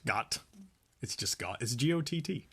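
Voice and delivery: ordinary delivery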